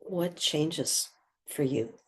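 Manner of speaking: ordinary delivery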